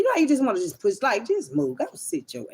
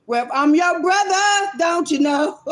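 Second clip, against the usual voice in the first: put-on higher and louder, teasing a kid who says bruh; mock teen retort; 9 semitones higher, 7 dB louder than usual. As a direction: throw it back at the kid, loud and high. Mocking kids' bruh talk.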